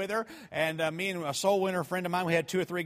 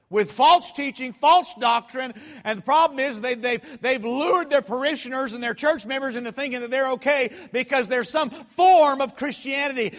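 loud, high, forceful pulpit warning; full-force preaching; 7 semitones higher, 7 dB louder than usual. Real, not acted. Preacher warning congregation about false teachers, impassioned. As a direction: hammer the warning home to the congregation.

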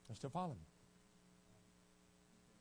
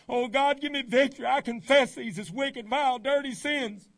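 hammed-up loud confession; 4 semitones higher, 10 dB louder than usual. Preacher playing a teen confessing at the altar, loud pleading. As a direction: play the desperate teen wailing his sins to God.

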